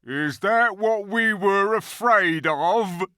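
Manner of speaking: high, loud, slow jeering creature; mocking creature. A narrator's reading. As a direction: sneer it out slowly. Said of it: "said another".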